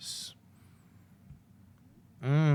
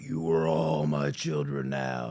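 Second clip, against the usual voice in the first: much lower, slow, looming villain voice; menacing villain; 10 semitones lower, slower than usual. Retold or imitated. Freddy claiming the kids at the party, slow and deep. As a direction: drag it out deep and ominous. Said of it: "he's like".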